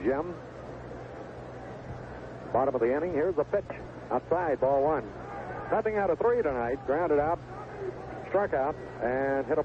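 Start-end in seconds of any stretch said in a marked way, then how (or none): none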